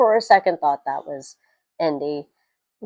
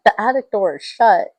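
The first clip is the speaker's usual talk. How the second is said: ordinary delivery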